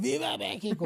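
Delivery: high mock holler, kept light; mock drunken battle cry. Drunk gunman comes back out, imitated battle cry. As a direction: imitate a rowdy cry without real volume. Drunk shooter shouting out before firing.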